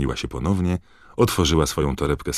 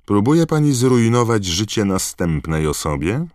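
raised, accusing question; loud; 7 semitones higher, 7 dB louder than usual. Acted. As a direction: call her out so all hear. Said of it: "zapytał głośno Harry".

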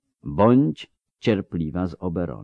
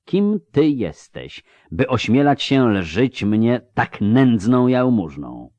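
higher, louder, slow and cutting; rising fury; 5 semitones higher, 4 dB louder, slower than usual. A narrator's reading. Avallac'h demanding who she is, slow and furious. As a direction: let the anger break through the calm. Elf's anger breaks through.

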